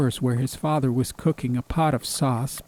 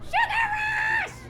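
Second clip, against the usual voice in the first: high, slow, drawn-out shout of joy; gleeful yell; slower than usual. Acted. Girl at the deli yelling to Mazzy, high gleeful shout. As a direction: sing it out high like a kid on a sugar high. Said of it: "She yelled".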